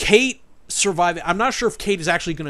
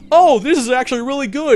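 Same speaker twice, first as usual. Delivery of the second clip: high, loud gushing praise; mock delight; 8 semitones higher, 8 dB louder than usual. Gamer imitating himself enjoying an episode, gushing loudly. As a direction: gush like a thrilled fan. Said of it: "a side of me where it's like".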